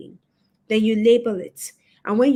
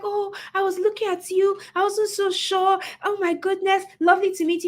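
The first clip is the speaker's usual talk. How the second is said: high, gushing fan voice; lightly voiced gushing admirer; 10 semitones higher than usual. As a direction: play the delighted woman meeting her idol.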